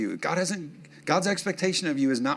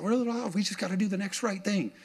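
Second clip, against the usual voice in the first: quoted rant; 7 semitones higher, faster than usual. Retold or imitated. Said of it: "And, uh, and I said".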